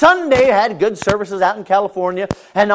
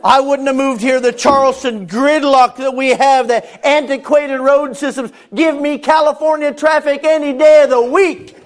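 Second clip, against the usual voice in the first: higher, louder, slowed preaching cadence; preaching at full force; 10 semitones higher, 8 dB louder than usual. Acted. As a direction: preach it with building fervor.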